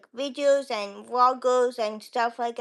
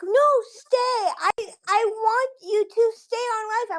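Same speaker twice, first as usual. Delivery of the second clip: high begging voice, much higher; pleading fans; 9 semitones higher than usual. Retold or imitated. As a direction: plead like a clingy fan. Subscribers begging her to stay.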